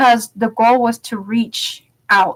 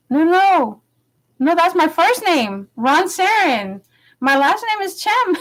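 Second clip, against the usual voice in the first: higher, insistent, correcting him; exasperated correction; 8 semitones higher than usual. Retold or imitated. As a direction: raise the voice to set him straight. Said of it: "I'm like".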